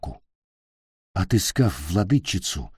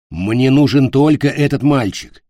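urgent insistence; 4 semitones higher, 6 dB louder than usual. A narrator's reading. Captain pleads facing a sword.

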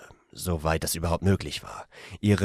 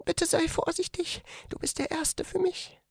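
much higher, softer female voice; young woman whispering; 5 dB quieter than usual. A narrator's reading. The woman asks him to be careful, much higher and softer. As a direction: plead gently and shyly. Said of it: "flüsterte sie".

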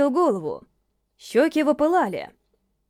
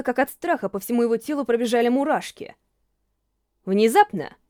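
ordinary delivery

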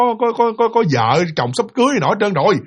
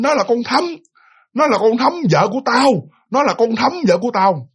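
higher-pitched mock outrage, hammering the claim; angry insistence; 5 semitones higher than usual. Possessed man claiming the woman as his wife, angry insistence. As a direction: imitate an enraged husband insisting.

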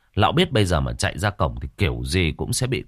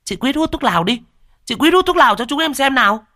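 high, loud fast goading; egging kids; 4 dB louder, faster than usual. Acted. Teens urging Quýt to smoke the pipe, high and loud. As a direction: goad her like cheeky teens.

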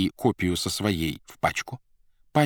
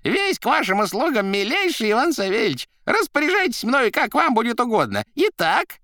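high, loud, unctuous rattle; fawning Koroviev; 5 dB louder than usual. A narrator's reading. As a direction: grovel in a high voice.

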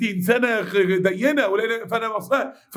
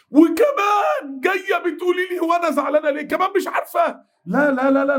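mock outraged scream at a clueless friend; furious shout; 9 semitones higher than usual.

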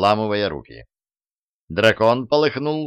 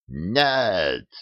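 dragon's roar, lightly voiced; huge fiery dragon roar. A narrator's reading. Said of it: "взревел дракон". (рёв дракона)

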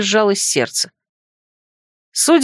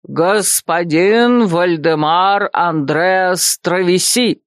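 slow, grand announcement of a name; solemn proclamation; 2 semitones lower, much slower than usual. Acted. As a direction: proclaim it slowly like a herald. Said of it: "торжественно провозгласил".

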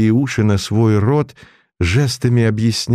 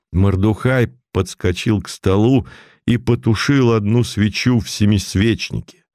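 plain reading voice

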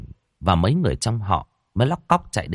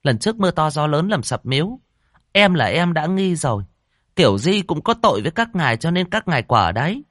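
higher, chattering gossip voice; gossipy woman chiming in; 9 semitones higher than usual. A narrator's reading. Gossiping woman cutting in, higher chatter. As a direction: butt in like a village busybody. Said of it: "con mụ lắm mồm khác cũng chen vào".

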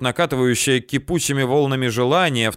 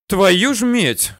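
испуганное восклицание; 9 semitones higher, 4 dB louder, much slower than usual. A narrator's reading. Испуганный герой вскрикивает.

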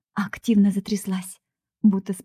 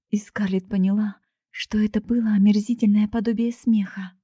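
ordinary delivery

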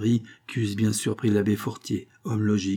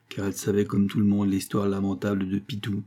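ordinary delivery

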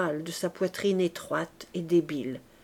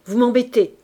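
put-on annoyed girl; 3 semitones higher, 9 dB louder than usual. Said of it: "dit Éponine".

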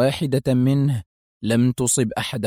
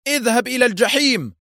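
high defiant retort; defiant shout; 11 semitones higher than usual. A narrator's reading. Hossam defying Foster over the loudspeakers. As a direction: fling the curse back. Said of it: "هتف حسام بالإنجليزية".

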